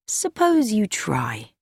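slow, gentle coaxing; kindly. A narrator's reading. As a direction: ease him into talking, kindly and slow. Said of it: "said in a kindly voice".

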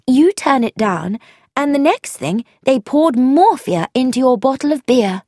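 pressing the point excitedly; eager young woman laying out the plot; Frankie, eager. Acted. Frankie voiced.

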